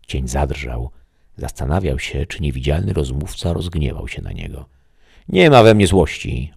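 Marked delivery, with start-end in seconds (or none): 5.3-6.5 much higher, louder reassurance; the voice answering. Acted. The voice reassures the shadow, higher and louder. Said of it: "odpowiedział mu głos".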